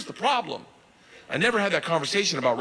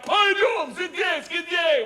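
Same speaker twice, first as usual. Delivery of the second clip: high, shouted foreign command; acted police shout, pitched way up; barked order; 3 dB louder, slower than usual. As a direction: bark it out like an impatient cop. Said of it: "I hear him bark out".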